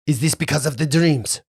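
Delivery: put-on French-accented suspicious question; half French accent. Captain asks about his dreams, French accent. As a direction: ask warily with a French accent. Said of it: "half French accent".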